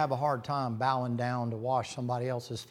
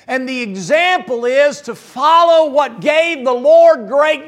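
loud, slow, high-pitched preacher's climb; preaching at full force; 17 dB louder, slower than usual. Acted. Preacher drives the lesson home to his church, full-force preaching. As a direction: pound it out to the congregation, building. Preaching about the example of service.

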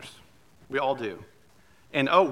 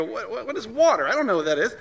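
higher, louder stammering confusion; baffled fish; 7 semitones higher, 4 dB louder, faster than usual. Pretend. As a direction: sputter like a fish who never heard of water. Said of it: "And they go".

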